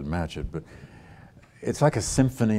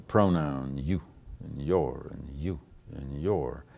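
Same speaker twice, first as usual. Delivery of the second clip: "you" and "your" hammered slow and lower; raining down the pronouns; 5 semitones lower, 5 dB quieter, slower than usual. Retold or imitated. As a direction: pound each "you" and "your" like an orator. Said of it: "to really rain down the".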